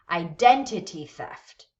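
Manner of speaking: "identity theft" with DEN as the main stress; stress on first word. Shown as a demonstration. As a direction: punch "den" in "identity", let "theft" follow lighter. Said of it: "first word is gonna be stressed".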